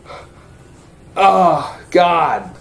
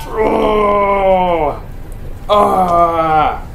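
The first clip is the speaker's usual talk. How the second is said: disgusted shout; 4 semitones higher than usual. Real, not acted. Reacting to gore.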